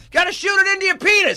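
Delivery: high, loud blurted yell; dad yelling. Retold or imitated. Dad yelling in public about the shot, loud. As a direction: shout it for everyone to hear. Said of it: "He just yells it out".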